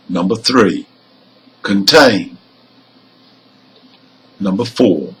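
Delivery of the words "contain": "contain" stressed on TAIN, weak start; last-syllable stress. Shown as a demonstration. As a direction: lean on the end of "contain". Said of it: "have stress on the".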